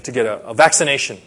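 normal talking voice

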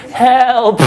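high, thin mock cry for help; weak teenage cry. Lost sixteen-year-old calling for rescue, in a weak little voice. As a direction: call out feebly like a scared teenager. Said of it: "in my weak little sixteen-year-old voice".

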